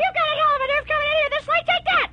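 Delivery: high, fast, shrill-voiced woman; shrieking old woman. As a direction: screech at the intruder at top speed. Rose beating intruder.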